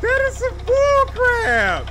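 very high, loud, drawn-out shriek; outraged yell. Real, not acted. Player cannot save the game, outraged yelling. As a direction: explode in a long high yell.

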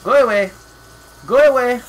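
loud shooing yell; shouting at rats. Real, not acted.

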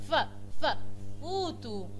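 "ف، ف" repeated, then "فوتو" said as futu with an f; sounding out photo. Shown as a demonstration. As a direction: break "فوتو" into its f sound before the word. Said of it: "نطقت فيها البي والاتش ازاي".

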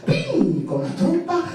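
"pin" muy alto y marcado; pin muy agudo. A narrator's reading. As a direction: sube la voz en "pin". Juego rítmico pin pon. ("pin" muy agudo y marcado)

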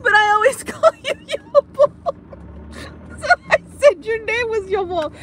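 can barely talk for laughing; laughing hard. Real, not acted. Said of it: "I'm laughing too hard".

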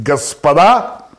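loud, slow, drawn-out proclamation; hammed-up officer's bellow; attention-grabbing announcement. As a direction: announce grandly to the whole ballroom. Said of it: "чтобы привлечь внимание".

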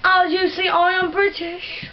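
hammed-up British presenter; British accent. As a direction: claim it in a put-on British accent. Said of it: "with a British accent".

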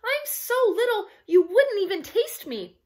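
put-on high-pitched pleading kid; squeaking baby goat. A narrator's reading. Baby goat talks troll out of eating him, squeaking. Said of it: "he squeaked".

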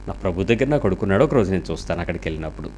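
low, soft, hurried; hushed faith. Retold or imitated. Grieving mother telling the pastor she'll see her son, hushed. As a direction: murmur it with quiet conviction.

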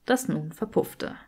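ordinary delivery

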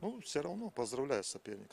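ordinary talk

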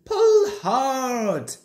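high, falling heaves of effort; puffing. A narrator's reading. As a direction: heave each phrase out like a straining engine. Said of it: "puffed Edward".

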